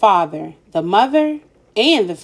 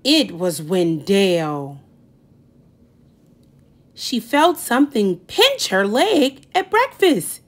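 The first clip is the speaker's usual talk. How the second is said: ordinary delivery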